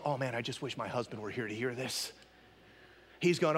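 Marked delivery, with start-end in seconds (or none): 0.0-2.1 low, soft muttered thought; inner thought. Retold or imitated. Churchgoer wishing husband heard the sermon, low private thought. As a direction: think it to yourself, low and soft. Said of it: "say, thinking to yourself".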